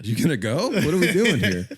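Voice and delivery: high, laughing mock impatience; passive aggressive. Driver to dawdling pedestrian, mock passive aggressive. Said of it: "I'm kinda, like, passive aggressive".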